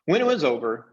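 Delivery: usual speaking voice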